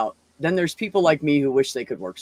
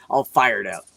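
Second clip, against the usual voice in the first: ordinary talk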